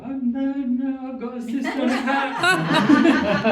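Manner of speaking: high, loud, laughing denial; laughing protest. Retold or imitated. Flatmate laughing while correcting a wrong guess. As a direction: squeal it out through laughter.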